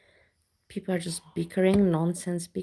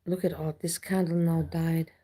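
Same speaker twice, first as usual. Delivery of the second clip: ordinary delivery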